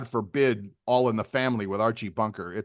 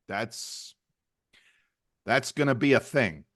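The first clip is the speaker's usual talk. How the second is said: usual speaking voice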